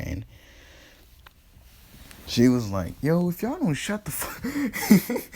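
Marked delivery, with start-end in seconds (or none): 3.0-5.2 high, drawn-out yell at the crowd; high slow mock yell. Tsunade yells at the screaming village, a high slow mock yell. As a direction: pitch it way up and stretch it, bossing the crowd.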